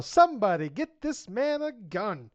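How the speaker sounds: mock yell, high, loud and drawn out; Lawrence Fishburne yell. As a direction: yell it slowly like a movie line. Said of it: "I see Lawrence Fishburne to yell".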